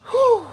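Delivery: loud high whew of amazement; amazed whew. Real, not acted. Overwhelmed by melons growing.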